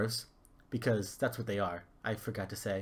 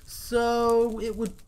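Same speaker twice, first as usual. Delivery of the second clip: drawn-out hesitation; 12 semitones higher, 8 dB louder, much slower than usual. Acted. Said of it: "huntsman hesitates".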